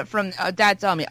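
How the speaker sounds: ordinary talk, nothing marked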